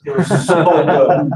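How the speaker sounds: slow, chuckling "so good"; laughing savour. Real, not acted. To the audience, remembering the gourmet pizza, laughing slowly. Fondly recalling Boston pizza.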